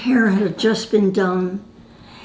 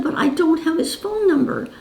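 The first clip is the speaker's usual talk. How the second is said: higher, anxious plea, imitated; worried pleading; 8 semitones higher than usual. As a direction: mimic a helpless, worried young woman. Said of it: "she was really serious about it".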